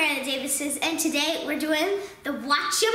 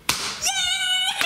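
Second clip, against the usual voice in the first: high laughing squeal of victory; shrieking with delighted laughter; excited shriek. Real, not acted. Wins the round, shrieking.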